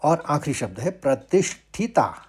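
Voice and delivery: normal talking voice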